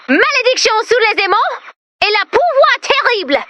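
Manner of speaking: mock French curse.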